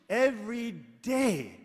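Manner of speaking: slow emphatic every day; stressed every day. Real, not acted. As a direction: lean hard on every day, stretching it. Preacher stressing daily routine.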